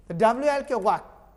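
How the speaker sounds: वाक said with the L silent; correct pronunciation. Shown as a demonstration. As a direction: say वाक without the L. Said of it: "वालक नहीं बोलना". (वाक, L मूक करके)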